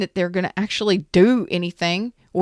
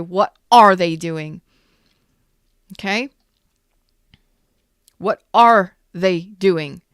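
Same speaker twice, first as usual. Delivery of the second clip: emphatic; 5 dB louder than usual. Real, not acted. Insisting on real action, repeated.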